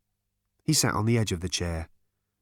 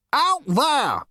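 very high, loud OUT THERE, falling at the end; high-pitched, emphatic exasperation on "Out there"; exasperated shout; 5 dB louder than usual. A narrator's reading. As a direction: snap "Out there" loudly in frustration. Zarniwoop exasperated, points at door.

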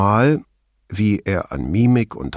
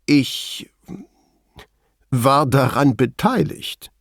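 slow and halting, pitched up; searching for words; 4 semitones higher, slower than usual. A narrator's reading. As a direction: pause long after the first word. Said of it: "als suche er nach den richtigen Worten". (zögernd und langsam, mit langer Pause)

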